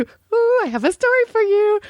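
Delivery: high-pitched, giddy tease; excited teasing. Retold or imitated. Telling Dee she has a story, giddy and high. Retelling herself teasing with news.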